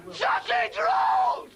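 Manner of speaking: high, slow, drawn-out shriek; high slow outraged shriek; shrieked outrage. Acted. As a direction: stretch it out in disgust. Ranting comic character.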